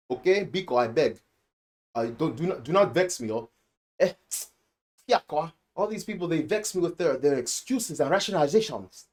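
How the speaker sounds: hammed-up Nigerian English complaint; Nigerian accent. Shown as a demonstration. Reviewer jokingly scolding excuse-makers, Nigerian accent. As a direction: play it vexed in a Nigerian accent. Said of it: "I can talk like that".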